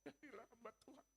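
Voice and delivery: mock tearful, high soft plea; tearful mother. Mother urging her son to serve God, tearful. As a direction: plead softly, imitating a weepy mom. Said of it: "kalau ngomong sama dia nangis".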